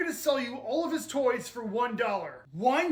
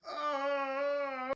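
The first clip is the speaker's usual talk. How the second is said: soft, long bellyache groan; pained groan; 6 dB quieter than usual. Acted. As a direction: groan weakly with a sore stomach. Boy with a bellyache.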